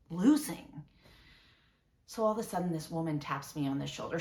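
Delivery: ordinary talk, nothing marked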